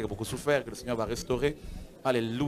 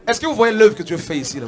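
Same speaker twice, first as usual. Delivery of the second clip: fervent exclamation; 7 semitones higher, 10 dB louder than usual. Real, not acted. Pastor urging congregation to look.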